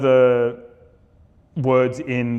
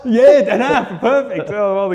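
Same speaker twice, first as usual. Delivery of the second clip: laughing; 9 semitones higher, 10 dB louder than usual. Real, not acted. Laughing at the app joke.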